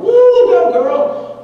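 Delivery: high, loud whoop; hollering. Retold or imitated. College guys cheering the wife from the lift, loud whoop. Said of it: "So now they start hollering".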